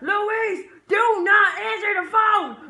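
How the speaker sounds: loud, high, slow shouted warning; frantic yelling. Pretend. Friend shouting at the screen character Luis, frantic. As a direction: shout him down from answering. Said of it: "And he's like".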